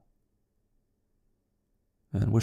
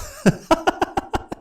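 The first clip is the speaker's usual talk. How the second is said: high, breathy unhinged giggle; a sudden madman's laugh; mad laugh. A narrator's reading. Killer delighting in his cleverness, unhinged laugh.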